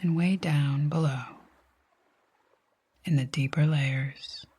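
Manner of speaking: calm meditation voice with long pauses; guided sleep hypnosis. Acted. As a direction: guide them gently to sleep, leaving long silences between phrases.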